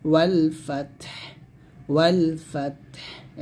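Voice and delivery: ওয়াল ফাতহ with both final consonants vowelless and airy; ওয়াল ফাতহ, t and h both released as breath; breath released at the end. Shown as a demonstration. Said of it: "বাতাস বের হবে".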